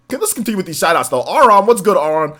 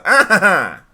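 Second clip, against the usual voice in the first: short mock villain cackle; a quick cartoon villain ha-ha; Mandark laugh; 3 semitones lower, 3 dB louder, much slower than usual. Streamer naming the Mandark laugh, quick mock cackle. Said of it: "That's the".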